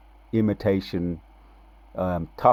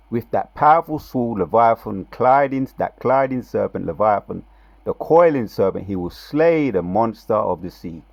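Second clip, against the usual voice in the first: scripture preaching; 3 semitones higher, 6 dB louder than usual. Acted. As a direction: proclaim the prophecy like a preacher.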